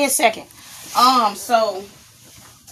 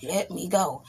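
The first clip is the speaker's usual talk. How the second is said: very soft choked plea, imitated; barely able to talk; 10 dB quieter than usual. Hoping friend doesn't notice the choking, barely able to talk. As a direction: squeeze out a faint, hushed request. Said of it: "I can barely talk".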